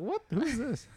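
sudden high mock surprise; surprised. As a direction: jump up in disbelief. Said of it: "I was like".

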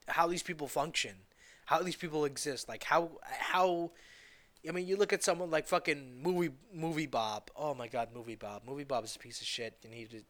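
ordinary delivery

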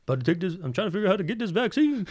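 high, fast pleading rant in a friend's voice; mock-frantic high-pitched chatter; agitated friend impression. Imitates friend's agitated rant about vaccine.

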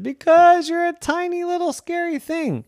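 high squeaky frightened voice; scared elephants. Acted. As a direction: squeal it like a big scared beast. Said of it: "And they all said".

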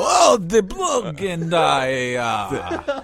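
slow, loud hammed-up Russian accent; mock Russian accent. Imitating a Russian driver in a fail video, loud hammed-up Russian accent. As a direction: drag out a shouted Russian accent for laughs. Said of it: "I can almost do a Russian accent".

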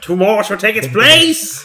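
loud, high, slow declaration; hammed-up battle-cry slogan; mock-dramatic proclamation. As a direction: proclaim it grandly, drawing it out. Mock-quoting a film villain's slogan.

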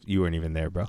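ordinary talk

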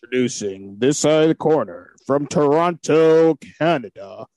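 slow, drawn-out fight-night introduction; ring announcer. Pretend. Mock boxing ring introduction.

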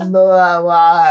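put-on high, loud girly Japanese speech; imitating his wife. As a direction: copy a young woman's way of talking. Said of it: "我就学她的样子".

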